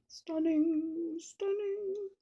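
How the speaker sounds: swooning over her own flower; playful rising gush. Pretend. Okay, sorry.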